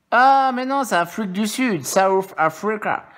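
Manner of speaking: high, loud sudden realisation; surprised. Real, not acted. Realising the coin he holds is from South Africa, surprised. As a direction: catch the mistake out loud.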